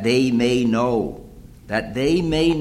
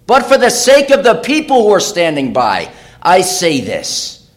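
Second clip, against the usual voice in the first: loud, high, climactic sermon build; preaching at full force; 8 semitones higher, 10 dB louder than usual. Acted. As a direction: lift the crowd to the climax. Repeated preached line.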